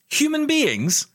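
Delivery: high incredulous echo; mock disbelief. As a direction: throw it back, teasingly astonished.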